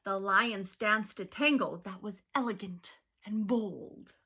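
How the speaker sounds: usual speaking voice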